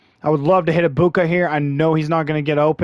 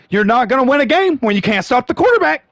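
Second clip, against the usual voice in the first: frustrated outburst; 7 semitones higher than usual. Real, not acted. Gamer ranting at the screen, frustrated outburst. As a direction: vent at the screen, pitch up.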